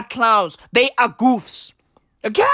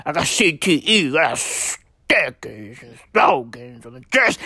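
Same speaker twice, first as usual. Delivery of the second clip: mocking Herschel Walker; 9 semitones lower than usual. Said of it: "Herschel Walker definitely has C T E".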